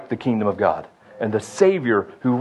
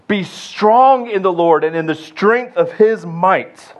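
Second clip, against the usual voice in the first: slow, emphatic, with "strong" punched; read with an exclamation point; 5 semitones higher, slower than usual. Shown as a demonstration. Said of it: "You could read this with an exclamation point".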